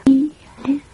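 high, teasing whispered name; mock stern. A narrator's reading. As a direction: whisper the name with pretend severity. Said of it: "with a mock stern expression".

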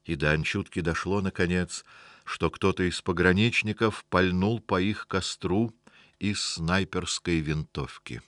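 ordinary delivery

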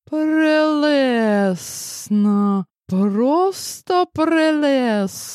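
high, slow venomous hiss; hissing sarcasm. A narrator's reading. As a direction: drag it out with poison. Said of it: "зашипела его невеста".

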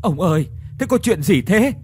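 high, loud, rushed calling; wife running up, calling to her husband in alarm; urgent calling out. Acted. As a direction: call out to him in alarm while running. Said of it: "vừa chạy vừa hỏi dồn".

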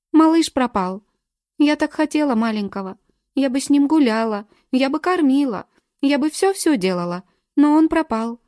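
put-on high, loud, childlike tearful rush; tearful pleading. A narrator's reading. Nina tearfully tells the detective about the baby, high and loud. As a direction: pour it out like a grieving child. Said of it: "по её полным щекам потекли слёзы".

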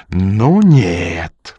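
swelling cry of refusal; beside himself with fury. A narrator's reading. The Pole refuses to drop it, furious. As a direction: cry out in angry defiance. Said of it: "вскричал поляк вне себя от ярости".

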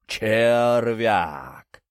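loud, drawn-out giant's bellow; крик великана. A narrator's reading. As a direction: stretch it out like a lumbering giant. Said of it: "снова выкрикнул великан". (протяжный громкий возглас великана)

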